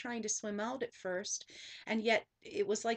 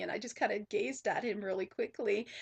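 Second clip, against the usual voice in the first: ordinary talk, nothing marked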